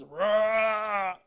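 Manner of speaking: Daddy Lion roaring to protect his cubs. Retold or imitated. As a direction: roar like a father lion. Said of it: "And Daddy Lion goes".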